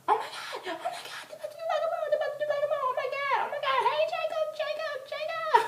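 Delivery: very high, loud squealing; reenacted fangirl frenzy. Fan seeing Jacob come out, frantic squealing. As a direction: squeal on and on, losing it.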